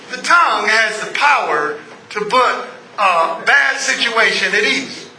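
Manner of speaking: usual speaking voice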